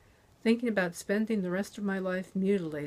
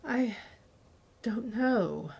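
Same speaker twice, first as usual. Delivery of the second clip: halting, higher first word, then a pause; catching breath; 3 semitones higher, slower than usual. A narrator's reading. Slapped captive answering interrogator, breathless and halting. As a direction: answer in two pieces, short of breath. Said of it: "I tried to catch my breath".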